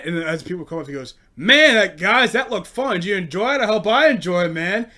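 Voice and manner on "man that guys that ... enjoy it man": loud, higher eager voice; mocking eager man. Comic mocking an eager man in line, loud and chirpy. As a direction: play the over-keen old guy.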